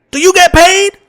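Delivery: brash, loud street voice; hammy raw comic swagger; Richard Pryor impression. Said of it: "Richard says".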